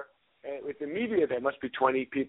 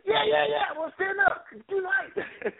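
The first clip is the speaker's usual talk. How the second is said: [name] impression; slower than usual. [name] voice, high, far.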